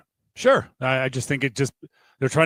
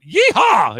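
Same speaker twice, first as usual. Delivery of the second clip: high, loud cowboy whoop; full-voiced, playful holler; shouted yeehaw; 8 semitones higher, 8 dB louder than usual. Shown as a demonstration. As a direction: shout it like a rodeo cheer. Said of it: "you'll shout".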